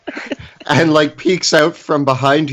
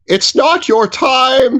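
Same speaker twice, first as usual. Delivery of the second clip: solemn; 11 semitones higher, slower than usual. Acted. Gnome comforts his frightened friend.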